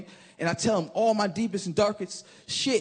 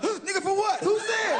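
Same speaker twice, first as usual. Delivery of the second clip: scared shout; 11 semitones higher than usual. Retold or imitated. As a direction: freak out at the name Utah. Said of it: "And I was scared for real".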